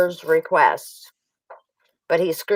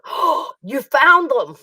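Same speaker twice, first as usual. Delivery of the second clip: mock caught surprise; 6 semitones higher, 5 dB louder, slower than usual. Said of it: "Like that".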